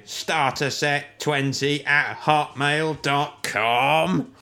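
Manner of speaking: slow, high, loud robot voice. Acted. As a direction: spell it out like a robot. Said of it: "why don't we have the robot tell you?".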